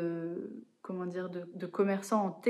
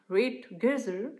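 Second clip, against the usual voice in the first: bad English accent; 3 semitones higher than usual. Shown as a demonstration. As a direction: Read Together, slow, in a poor English accent. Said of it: "j'ai un accent anglais, euh, complètement pourri".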